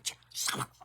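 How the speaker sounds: low-volume, whispered re-enacted telling-off; hushed shushing. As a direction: shush him under your breath. Shushing husband in public.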